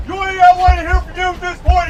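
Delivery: normal talking voice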